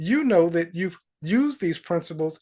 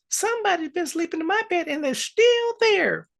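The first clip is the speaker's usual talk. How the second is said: very high little bear cub voice; Baby Bear; 12 semitones higher than usual. Acted. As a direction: squeak out a small cub's complaint. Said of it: "Baby Bear says".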